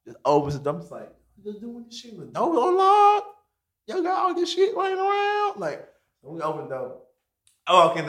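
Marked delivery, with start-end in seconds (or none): 1.4-5.6 high incredulous voice; can't believe the door's unlocked; astonished question. Retold or imitated.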